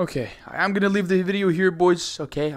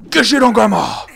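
higher, louder jeer; loud taunt; 6 semitones higher, 7 dB louder than usual. Real, not acted. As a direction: yell the insult at her. Final taunt.

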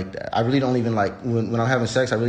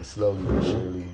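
usual speaking voice

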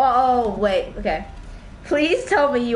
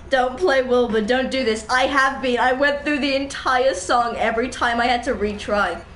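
ordinary delivery

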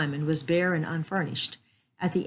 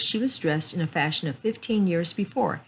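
plain reading voice

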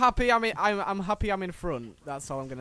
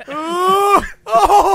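loud, high wordless cry, building; dismayed yell; 9 semitones higher, 10 dB louder than usual. Real, not acted. Player reacting to crash, loud dismayed yell. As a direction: yelp out at the crash.

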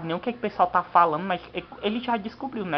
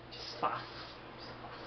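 hushed, timid whisper; 15 dB quieter than usual. Acted. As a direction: whisper it shyly under breath. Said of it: "dizer de uma forma tímida".

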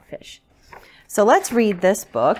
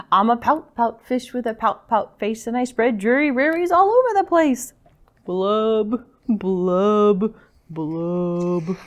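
sing-song mopey fish with drawn-out blubs; glum pout-pout fish; 3 dB louder, slower than usual. Acted. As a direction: chant the rhyme, sinking into gloom. Gloomy fish's refrain.

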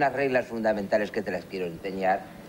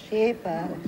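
put-on higher boyish Sí, padre; son answering; 10 semitones higher than usual. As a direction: play the obedient little boy. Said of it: "¿Estás anotando?".

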